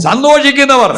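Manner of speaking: high, loud pulpit echo; preaching. Real, not acted. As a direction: proclaim the word back to the crowd. Preacher echoes the phrase loudly.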